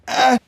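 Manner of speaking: sudden high-pitched yelp. Acted.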